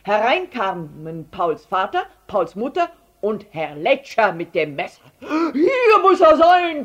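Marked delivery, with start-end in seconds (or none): 5.2-6.8 high, slow, wheezing shout; loud panting. A narrator's reading. Herr Letscher bursting into the room, loud panting. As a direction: heave it out, out of breath from running. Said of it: "keuchte Herr Letscher".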